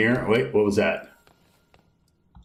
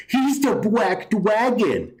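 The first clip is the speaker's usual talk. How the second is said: put-on high, slow baby-talk coo; mock toddler voice; 9 semitones higher, much slower than usual. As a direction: talk down to him like a little child. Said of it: "like he would talk to a child".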